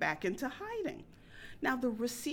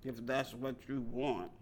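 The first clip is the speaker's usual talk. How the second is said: low, much softer murmur; 9 semitones lower, 5 dB quieter than usual. Retold or imitated. Man at the bar murmuring from behind his burger. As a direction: mumble it low and soft. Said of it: "he murmured".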